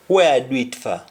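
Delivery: "where I do it for" in a Jamaican Patois lilt, "for" as "fa"; a puzzled Patois question. Shown as a demonstration. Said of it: "somebody would say".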